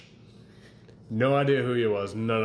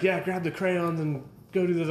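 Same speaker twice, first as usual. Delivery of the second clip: higher, breezy mock voice of know-it-all raiders; mock gamer know-it-all; 8 semitones higher than usual. Mocking raiders who already named everything, high and breezy. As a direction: toss it off like a raider who has it all figured out. Mocking other raid players' jargon.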